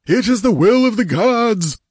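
high, ringing priestly declamation; exalted proclamation. A narrator's reading. Priest hails the gods' will and begs the goddess's leave, exalted. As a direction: declaim it with fanatical reverence. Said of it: "he cried in an exalted voice".

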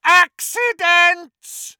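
very high, loud, drawn-out shriek; enraged scream. A narrator's reading. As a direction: scream the word in outrage. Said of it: "screamed Voldemort".

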